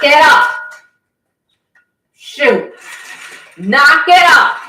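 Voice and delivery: high, loud shout to stop the fighting; exasperated call-out, real and loud; sharp scolding shout. Real, not acted. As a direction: yell to break up a squabble, drawing it out. Scolding fighting animals off-camera.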